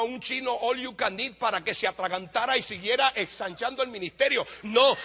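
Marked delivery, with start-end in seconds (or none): 4.6-5.0 loud, driving preacher's cry rising to a shout; preaching at full force. Acted. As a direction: hammer it home like a fiery revival preacher. (predicación a todo pulmón, gritando)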